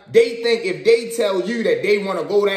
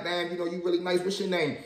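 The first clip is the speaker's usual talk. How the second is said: smooth-talking softly; 10 dB quieter than usual. Retold or imitated. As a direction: ease it out quietly to win her over. Smoothing over the guard.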